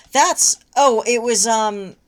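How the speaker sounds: excited rant. Real, not acted. Reader gushing to cohost about the fic. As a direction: gush about it loudly.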